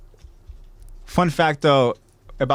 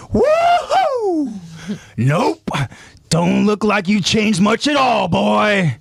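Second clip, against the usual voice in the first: put-on high whooping villain shout with an accent; Kenny the Ripper; 6 semitones higher, much slower than usual. Villain flies up to surround Levi, gleeful accented holler. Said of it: "as he says".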